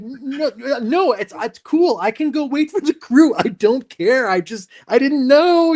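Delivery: calm him down, it's no big deal; imitating a gentle actor reassuring a PA. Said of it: "I'm like".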